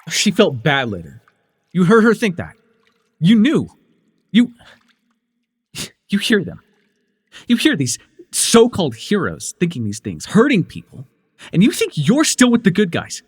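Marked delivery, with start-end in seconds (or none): 1.7-3.7 higher, louder, furious accusation; angry accusation. Acted. Accusing the young hero. 4.3-13.2 higher, louder rising tirade; angry tirade. Acted. Accusing the young hero.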